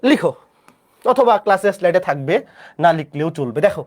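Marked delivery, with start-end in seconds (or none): none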